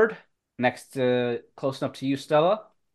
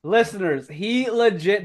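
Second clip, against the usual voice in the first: ordinary delivery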